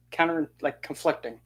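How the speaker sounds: usual speaking voice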